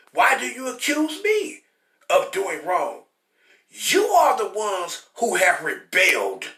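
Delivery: slow, deliberate preaching read; scripture reading. Acted. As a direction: read the verses like a sermon. Preacher reading God's words.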